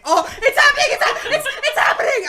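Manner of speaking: high, loud laughing squeal; giddy with anticipation, laughing; loud high excited chant. Retold or imitated. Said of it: "and I was like".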